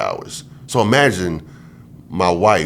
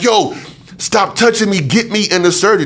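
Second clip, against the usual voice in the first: angry demand; 11 semitones higher than usual. Retold or imitated. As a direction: bark at the doctors to get on with it. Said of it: "I'm angry".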